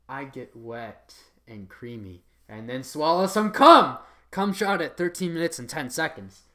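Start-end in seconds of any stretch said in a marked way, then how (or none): none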